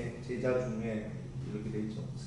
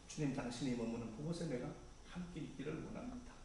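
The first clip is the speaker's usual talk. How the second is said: soft, hushed and earnest prayer; softer heartfelt whisper of devotion; tender confession; 9 dB quieter than usual. Retold or imitated. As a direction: confess your longing quietly to the Lord. Disciples' longing voiced as prayer.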